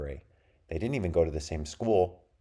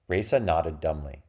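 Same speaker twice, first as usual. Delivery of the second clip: ordinary delivery, nothing marked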